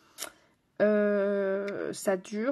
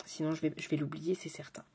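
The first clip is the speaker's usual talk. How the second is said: soft whispered aside; hushed reminder to self; 4 semitones lower, 6 dB quieter than usual. Real, not acted. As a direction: whisper it to yourself. Aside to herself.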